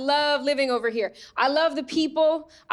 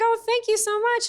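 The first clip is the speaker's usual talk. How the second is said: higher, sugary thank you; sweet high voice; 8 semitones higher than usual. Retold or imitated. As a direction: chirp it sweetly. Replying sweetly to a compliment.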